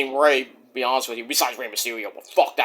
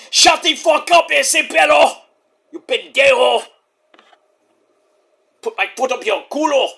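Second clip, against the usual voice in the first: high, hammed-up Mexican accent; Eddie Guerrero impression; 7 semitones higher than usual. Host defiantly showing his impression, hammed-up Mexican accent. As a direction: curse in a mock Mexican accent. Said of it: "my Eddie Guerrero impression".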